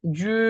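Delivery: জু drawn out through a narrowed mouth; nearly closed mouth. Shown as a demonstration. Teacher showing the hardest sounds, mouth slightly closed. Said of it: "মুখটাকে বন্ধ করে একটু".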